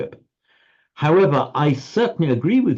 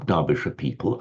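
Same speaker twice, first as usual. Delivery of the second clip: ordinary delivery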